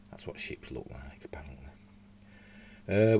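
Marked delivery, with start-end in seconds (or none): 0.1-1.6 whispering. Real, not acted. Whispered aside about a picture.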